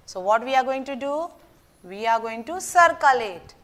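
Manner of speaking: ordinary talk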